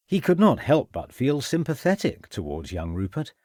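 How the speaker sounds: ordinary delivery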